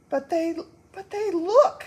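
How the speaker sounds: high, loud urgent cry; urgent exclamation. Acted. Wife insisting humans do look, high urgent cry. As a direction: blurt the fear out. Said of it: "exclaimed Homily".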